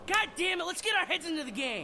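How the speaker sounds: high, loud angry curse; frustrated outburst. Acted. Quarterback curses team after penalty, angry shout. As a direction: blow up at the team.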